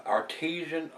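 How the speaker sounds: Artesian, said ar-TEE-zhun; wrong pronunciation. Shown as a demonstration. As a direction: stress the TEE in Artesian, with a zh sound. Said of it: "but that's not right".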